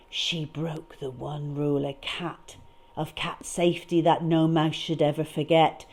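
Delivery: usual speaking voice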